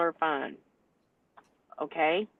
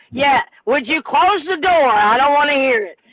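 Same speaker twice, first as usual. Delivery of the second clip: exasperated call to shut the door; impatient loud request; 6 semitones higher, 6 dB louder than usual. Real, not acted. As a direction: repeat it like they didn't listen. Repeats the request, louder and impatient.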